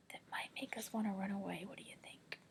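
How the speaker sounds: hushed breathy whisper. Real, not acted. Teacher whispers her question to the class.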